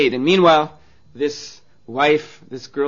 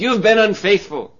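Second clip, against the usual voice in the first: accusing; 6 semitones higher, 7 dB louder than usual. Acted. Husband accusing wife.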